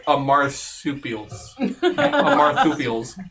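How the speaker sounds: Amarthupials with a lisp, s as th. Shown as a demonstration. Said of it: "You gotta say it with a lisp".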